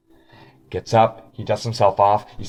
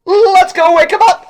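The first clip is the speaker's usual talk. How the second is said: very high and loud; gleeful shout; 9 dB louder than usual. Acted. Guide urging the group to wake the orc. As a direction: cheer it like a mischievous kid. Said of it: "He goes".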